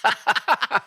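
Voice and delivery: high laughing protest. Real, not acted. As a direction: laugh it out in mock outrage. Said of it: "laugh shout".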